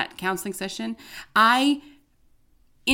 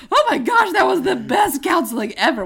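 gushing excitement; 9 semitones higher, 5 dB louder than usual. Retold or imitated. Retelling leaving counseling overwhelmed.

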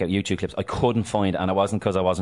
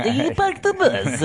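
hammed-up Russian accent, much higher and very slow; mock Russian accent drawn out for a laugh; 4 dB louder, much slower than usual. Host quoting Mourinho to his co-host in a jokey Russian accent. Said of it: "I can't help but do a Russian accent for Portuguese".

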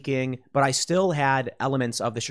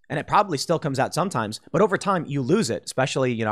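normal talking voice

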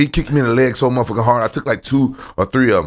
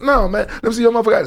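mimicking Yakuza guys; 12 semitones higher, faster than usual. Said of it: "I'm like".

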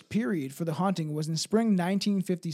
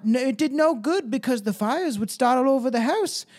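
higher-pitched, put-on British accent; 4 semitones higher, 4 dB louder than usual. Shirley recalling the haunting, put-on British accent. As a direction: keep up an acted British accent for the testimony. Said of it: "I got the British".

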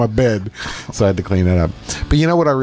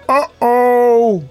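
higher, drawn-out worried uh-oh; old man's uh-oh; 10 semitones higher than usual. Retold or imitated. Imitating the patient getting off the table. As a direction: say it like a patient realizing trouble. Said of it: "and he's like".

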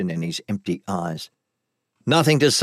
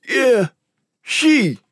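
high-pitched, panicky captive voice; frightened lowlife; 13 semitones higher, 4 dB louder than usual. Acted. Captive pleading with his interrogators, high and frightened. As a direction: protest your innocence in a high voice.

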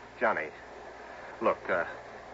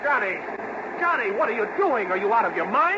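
alarmed shout; 7 semitones higher than usual. Acted. Rudy yells at Johnny at dam.

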